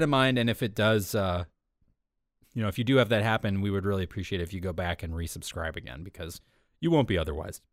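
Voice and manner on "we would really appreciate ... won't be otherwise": ordinary talk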